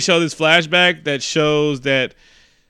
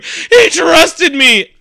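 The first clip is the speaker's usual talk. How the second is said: loud, high, drawn-out mock cry; hammed-up anguished wail; 11 semitones higher, 6 dB louder, much slower than usual. Android lamenting the killed creature, hammed-up tearful wail. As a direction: wail it slowly like a heartbroken melodrama villain. Acts android after killing the alien.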